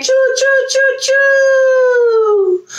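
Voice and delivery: high whistling choo choo; train whistle. Pretend. Imitating a train.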